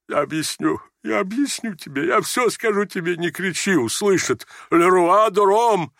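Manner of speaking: hushed high pleading breaking into slow wails; plead, then wail with long-held vowels; distraught old general. A narrator's reading. (жалобный шёпот-мольба, переходящая в протяжный вопль)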